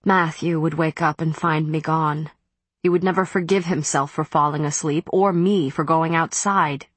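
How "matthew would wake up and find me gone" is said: ordinary delivery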